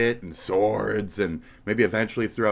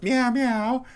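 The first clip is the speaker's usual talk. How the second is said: high, louder mock meowing like a cat; cat meows; 14 semitones higher, 4 dB louder than usual. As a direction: meow like a prissy cat. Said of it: "doing cat cat stuff".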